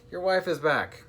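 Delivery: high, urgent yelled warning. A narrator's reading. Percy warning the captain, yelling. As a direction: yell the news down to the deck. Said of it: "yelled Percy as he fell".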